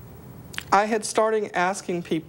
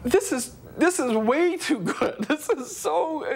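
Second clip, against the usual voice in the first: higher and slower, laughing with joy; overwhelmed laughter; 6 semitones higher than usual. Retold or imitated. Feeling forgiven, a slow laughing high exclamation. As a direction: laugh in disbelieving relief. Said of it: "And I was like".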